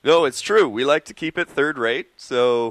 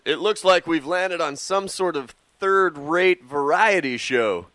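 higher, louder declaration of dismay; Luke Skywalker; 4 semitones higher, 5 dB louder than usual. Retold or imitated. Co-host quoting Luke on the Muppets, mock-earnest hero. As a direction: announce it like a put-out space hero. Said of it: "Luke says".

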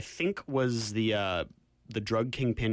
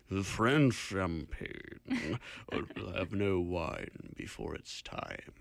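very slow, lower wine-commercial pronouncement; Orson Welles; 3 semitones lower, 3 dB quieter, much slower than usual. Retold or imitated. Imitating Welles selling wine in old commercials, slow impression. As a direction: draw it out grandly, sinking softer. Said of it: "I don't know if that was a good impression".

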